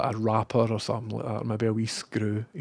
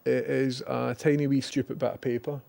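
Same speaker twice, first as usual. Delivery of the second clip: ordinary talk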